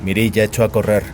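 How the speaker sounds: ordinary delivery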